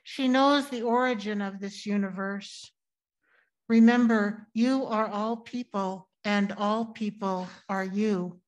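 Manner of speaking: usual speaking voice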